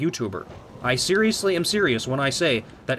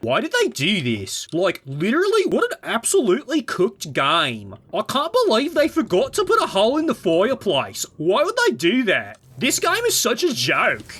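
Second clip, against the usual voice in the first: high, swooping whiny rant; mock outraged YouTuber; 7 semitones higher than usual. Mocking critics over a missing fireplace hole, high whiny outrage. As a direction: build into hammed-up baffled indignation. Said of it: "when I talk like Half-Life two voice".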